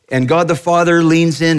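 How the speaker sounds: normal talking voice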